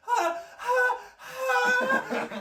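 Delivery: high loud mock crying; imitated toddler wail. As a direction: wail like a startled four-year-old. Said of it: "she was just like".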